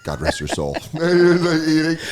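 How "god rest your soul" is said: mock solemn.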